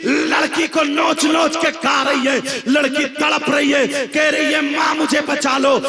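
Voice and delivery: loud, driving sermon over music; fiery preaching. Acted. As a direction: thunder the warning at the congregation. Preaching at full force.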